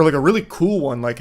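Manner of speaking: normal talking voice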